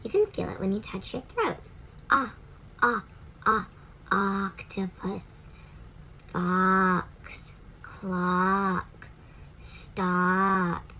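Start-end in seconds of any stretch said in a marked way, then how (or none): 2.1-5.5 ah repeated, then octopus slow with open ah; ah sound. Shown as a demonstration. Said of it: "pushing that sound up". 6.3-10.9 fox, clock and stop with a clear open ah; ah in words. Shown as a demonstration. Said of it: "You hear the ah?".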